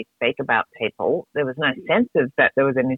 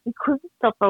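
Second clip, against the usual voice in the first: usual speaking voice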